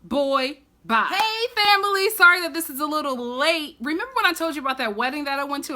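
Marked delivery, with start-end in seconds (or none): none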